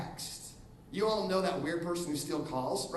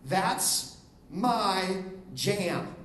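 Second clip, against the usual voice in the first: slow demo; much slower than usual. Shown as a demonstration. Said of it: "let me give you word content".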